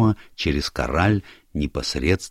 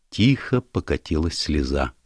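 ordinary delivery, nothing marked

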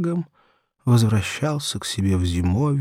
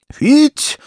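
high, loud whistle-like shout; whistling call; 10 dB louder than usual. Acted. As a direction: call it out sharp like a whistle to the dog. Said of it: "засвистал Игнат".

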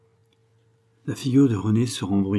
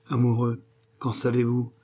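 ordinary delivery, nothing marked

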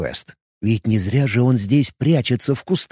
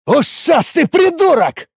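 very high, drawn-out angry holler; sudden yell; 14 semitones higher, 3 dB louder, slower than usual. A narrator's reading. Warrior snapping at the elf player, yelling. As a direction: burst out yelling the insult. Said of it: "неожиданно заорал непись".

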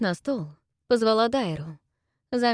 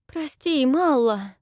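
breathed apology; 7 semitones higher, 5 dB louder, much slower than usual. Acted. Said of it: "выдохнула Дайра".

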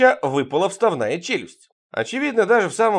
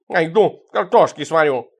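louder, higher angry announcement; riled cat; 3 semitones higher, 6 dB louder than usual. A narrator's reading. Cat Tikhon leaves to boil potatoes, loudly riled. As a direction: announce it crossly as a cat storming off.

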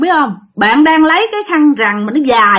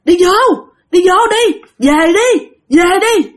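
high pleading call from afar; loud call; 5 semitones higher than usual. Retold or imitated. A mysterious voice calls the child back, loud and urgent. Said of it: "tiếng kêu lớn lắm".

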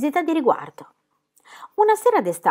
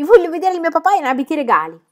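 high, loud, eager urging; excited child's shriek; 9 semitones higher, 7 dB louder than usual. A narrator's reading. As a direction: squeal it with impatience. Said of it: "strillò Pippi".

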